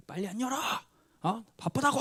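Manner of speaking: high pitched mock yell, pitch jumping up. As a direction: shout at the recruit to open up in a high voice. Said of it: "소리를 지르는 거예요".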